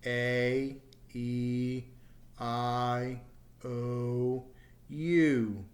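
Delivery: sustained, separate vowels A, E, I, O, U; vowel demonstration. Shown as a demonstration. Teacher has learners feel throat vibration, vowel demonstration. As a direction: hold each of A, E, I, O, U evenly. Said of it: "any vowel sound will do this".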